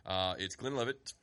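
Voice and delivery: ordinary delivery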